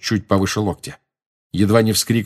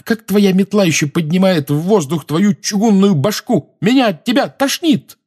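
high-pitched scolding, building to an outburst; indignant rant rising to a cry; furious tirade; 11 semitones higher, 4 dB louder than usual. A narrator's reading. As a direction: tear into him, pitch climbing. Lily's furious tirade at James.

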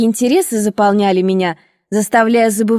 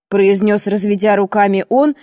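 usual speaking voice

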